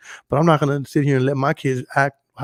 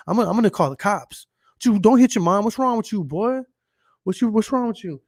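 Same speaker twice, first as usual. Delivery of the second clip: higher-pitched, mock-outraged telling-off; indignant scolding; 5 semitones higher, 3 dB louder than usual. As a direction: act out berating someone else's kid. Retold indignant scolding of the kid.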